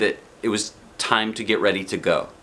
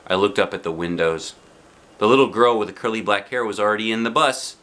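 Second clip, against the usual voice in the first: ordinary delivery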